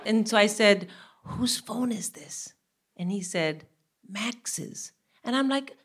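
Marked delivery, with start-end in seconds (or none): 1.2-2.5 slow, soft whispered question; hushed and secretive; re-enacted whisper. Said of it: "Why am I still whispering". 4.1-4.9 very soft, secretive answer; hushed son's reply; imitated whisper. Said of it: "Why am I still whispering".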